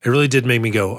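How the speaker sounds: ordinary delivery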